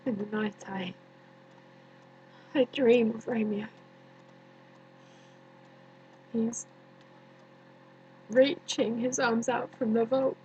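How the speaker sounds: hushed, halting, long pauses; quiet, sorrowful recollection with space between phrases; haunted grief. Acted. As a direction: sink softly into a haunting dream. Recalls tomb, haunted by dreaming of Romeo.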